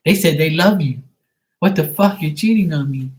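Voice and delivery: hammed-up jealous yell at him; mock jealous outburst.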